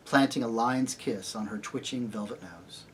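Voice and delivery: ordinary delivery, nothing marked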